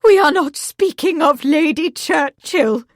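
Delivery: mother snapping at daughter; cried. Acted. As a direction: cut her daughter off. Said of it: "Lady Marsden cried".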